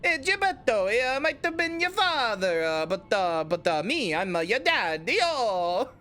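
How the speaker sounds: hammed-up Italian accent, starting high and drawn out; playful cartoon Italian accent with big swoops. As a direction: ham it up in a mock Italian accent. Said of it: "let me do that in Italian accent".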